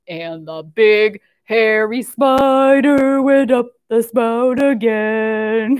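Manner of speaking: slow, heavy spider voice; big hairy spider voice. Pretend. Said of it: "get out your big hairy spider voices".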